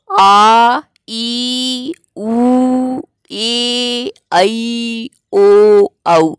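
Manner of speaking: each vowel "आ", "ई", "उ" stretched long and high; drawn-out long vowels. Shown as a demonstration. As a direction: hold every vowel sound long, one by one. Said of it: "जिन्हें कुछ खींच कर बोला जाता है". (लंबा खींचकर स्वर)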